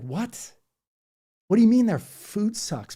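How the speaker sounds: normal talking voice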